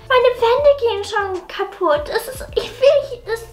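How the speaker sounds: a hint of a hurt twelve-year-old pleading with Mama; daughter voice.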